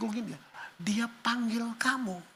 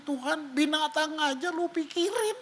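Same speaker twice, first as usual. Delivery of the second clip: crying prayer; 7 semitones higher than usual. Retold or imitated. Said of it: "saya duduk berapa detik nangisnya".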